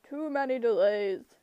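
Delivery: loud Cyclops bellow; bellowing monster. A narrator's reading. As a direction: roar the complaint. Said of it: "the monster bellowed".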